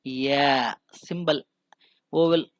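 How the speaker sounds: या drawn out slowly; teacher slowly names the symbol; slow vowel model. Shown as a demonstration. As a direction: stretch the vowel या. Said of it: "शुरू में क्या लिखा हुआ है".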